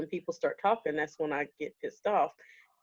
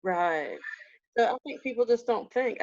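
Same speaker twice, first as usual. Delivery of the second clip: ordinary delivery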